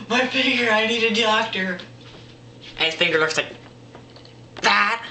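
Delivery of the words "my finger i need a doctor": high-pitched tearful wail, hammed up; mock crying. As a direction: whine like a hurt crybaby. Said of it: "And he's crying".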